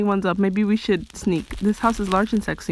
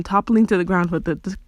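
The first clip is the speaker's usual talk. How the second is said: ordinary delivery, nothing marked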